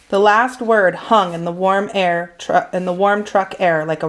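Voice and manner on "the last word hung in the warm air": usual speaking voice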